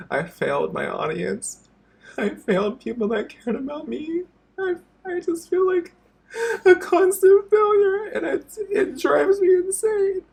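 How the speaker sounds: words squeezed out through real sobs; sobbing. Real, not acted. YouTuber telling viewers he feels like a failure, sobbing. Breaks down over being a failure.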